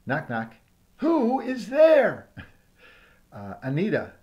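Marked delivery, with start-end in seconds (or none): 1.0-2.3 slow, loud, higher hammed-up who is there; drawn-out answer. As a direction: stretch every word out loudly.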